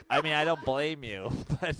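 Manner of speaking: real laugh breaking into the words. Real, not acted. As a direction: crack up while trying to go on. Laughing about what killed the dog.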